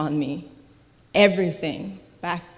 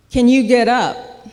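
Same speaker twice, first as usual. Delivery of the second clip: loud, raised-pitch imitated medic; calling out; 5 semitones higher, 9 dB louder than usual. Imitated medic to a patient on the ground, calling loudly. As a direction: call out as if to someone collapsed.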